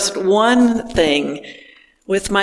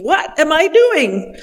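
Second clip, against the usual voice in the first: panicked; 8 semitones higher than usual. Retold or imitated. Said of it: "probably thinking".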